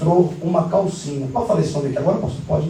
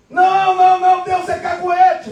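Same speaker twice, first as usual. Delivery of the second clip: high mock wail; hammed-up high-pitched denial; panicked protest; 9 semitones higher than usual. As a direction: protest in alarm as if just exposed. Man protests being exposed.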